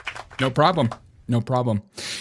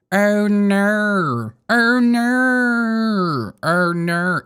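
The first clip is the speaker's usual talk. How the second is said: higher, stretched Australian "oh no"; drawn-out Australian "oh no", pitched up; Aussie "oh no"; 6 semitones higher, much slower than usual. Shown as a demonstration. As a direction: parody the Australian way of saying no. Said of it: "Why do you guys say it like that?".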